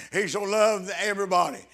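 ordinary delivery

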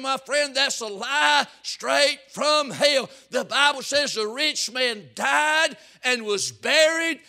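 loud, high fire-and-brimstone preaching; preaching at full force. Acted. Preacher warning the church about hell, full-force shouting. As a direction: thunder it at the congregation.

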